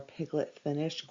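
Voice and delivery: ordinary delivery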